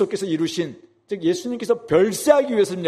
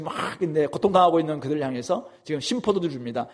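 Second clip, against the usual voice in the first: ordinary talk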